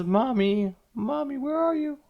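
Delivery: high, drawn-out wail for mother; acted little girl crying out for her mom. A narrator's reading. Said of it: "she starts crying and she says".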